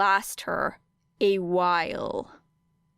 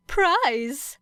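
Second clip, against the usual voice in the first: gleeful, higher "Prize?" with a rising lilt; crowing; 6 semitones higher than usual. Acted. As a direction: crow "Prize?" in delight. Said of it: "Nezu crowed".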